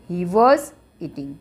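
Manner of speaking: each word drawn out slowly for writing; slow dictation pace for students. Shown as a demonstration.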